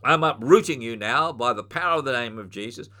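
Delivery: loud, forceful command, peaking on "from my life"; commanding rebuke. Shown as a demonstration. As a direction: rebuke the anger like casting out a spirit. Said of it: "you speak to your angry mood and you say".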